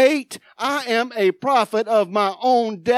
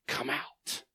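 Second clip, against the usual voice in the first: normal talking voice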